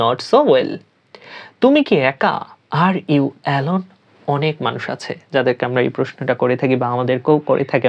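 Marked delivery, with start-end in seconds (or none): none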